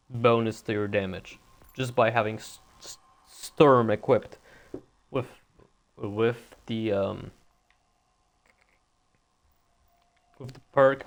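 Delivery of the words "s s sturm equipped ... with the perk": real stutter, catching on the first sound. Real, not acted. Streamer explaining the perk to viewers, stuttering badly. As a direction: get stuck, repeat words and pause to get going. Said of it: "I'm sorry I'm stuttering so badly today".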